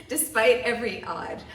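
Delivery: loud burst of amused emphasis; laughing. Real, not acted. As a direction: push the words out through a laugh. Laughs at reading despite odds.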